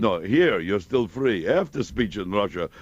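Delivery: put-on slow, lightly Russian-accented joke; a hint of a Russian cabbie telling a joke. Jack retelling the cabbie's joke, slow light Russian accent.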